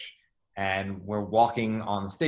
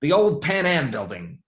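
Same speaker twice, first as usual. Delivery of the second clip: slow, higher old-man voice imitating Stan; mock Stan Lee; 5 semitones higher, 6 dB louder, slower than usual. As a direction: lightly voice an old man marveling. Retelling Stan Lee's remark.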